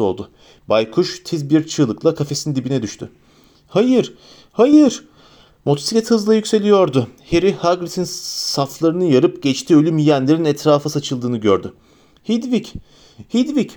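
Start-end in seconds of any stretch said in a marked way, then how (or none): 3.7-5.0 high, loud despairing cry; anguished shout. A narrator's reading. Harry sees his owl hit, despairing shout. 12.2-13.7 high pleading calls; desperate calling. A narrator's reading. Harry calls to his fallen owl, desperate.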